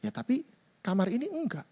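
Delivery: soft Holy Spirit reply. Acted. Holy Spirit answers the complaining believer, softly. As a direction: quietly point out one room is untouched. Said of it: "Roh Kudus bilang".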